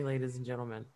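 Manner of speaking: ordinary delivery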